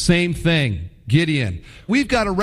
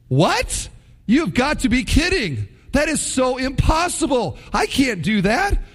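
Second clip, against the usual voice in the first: exasperated disbelief; 6 semitones higher than usual. Retold or imitated. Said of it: "it's like".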